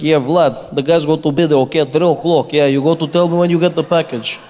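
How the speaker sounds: fast mock Russian phone call, half English half Russian; imitating a Russian man barking into a phone; Russian accent. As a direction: play a Russian guy on the phone setting up a deal. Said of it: "then he switched into Russian".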